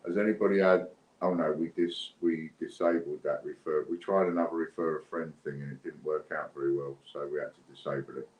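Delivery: ordinary talk, nothing marked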